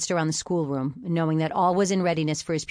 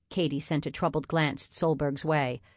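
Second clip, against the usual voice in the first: ordinary delivery, nothing marked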